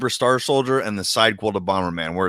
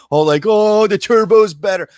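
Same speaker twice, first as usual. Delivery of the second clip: loud, high-pitched mocking whine; mocking fanboy; 11 semitones higher, 7 dB louder than usual. Player mimics fans who favour the Turbo, mocking. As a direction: parody a gushing fan, pitched up and louder.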